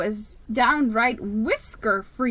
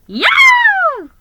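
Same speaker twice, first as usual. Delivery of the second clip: very high, loud yell; 12 dB louder than usual. Acted. Elf yelling as the sled speeds up. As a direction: whoop with glee. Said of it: "he yelled".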